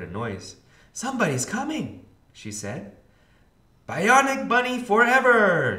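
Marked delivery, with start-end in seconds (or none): 0.9-2.1 put-on high, eager kid voice; lightly voiced little sister; little girl voice. A narrator's reading. DW's high child voice. 3.9-5.8 high, loud rallying cheer; shouting. A narrator's reading. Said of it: "shouted Buster".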